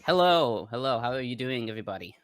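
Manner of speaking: ordinary delivery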